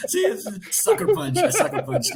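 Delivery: high, loud laughing. Real, not acted. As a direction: crack up on it. Laughing after prank.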